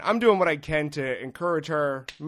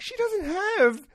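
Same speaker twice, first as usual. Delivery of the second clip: much higher, louder mock disbelief; incredulous inner voice; 9 semitones higher, 4 dB louder than usual. Comic reacting to the feeble lady helping, high incredulous. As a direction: squeal the disbelief at her asking. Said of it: "I'm like".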